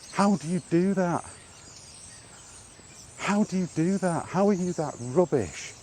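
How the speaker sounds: usual speaking voice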